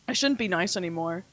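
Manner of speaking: ordinary delivery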